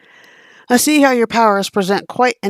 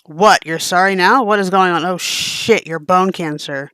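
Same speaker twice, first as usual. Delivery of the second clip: ordinary delivery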